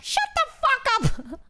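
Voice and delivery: high mock angry snap; high squeaky shut up; friends snapping. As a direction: fire it back in fake outrage. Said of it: "they're like".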